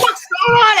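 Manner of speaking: high, startled question; shocked. Retold or imitated. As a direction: yelp it in disbelief. Retold shock at friend peeing.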